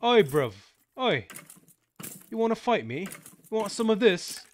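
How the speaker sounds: high, loud, hammed-up British street accent; playful mock-British taunt while fighting in Minecraft; mock British chav. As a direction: squeak out a tough-guy British challenge. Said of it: "That sounds more like Australian".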